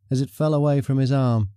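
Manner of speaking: plain reading voice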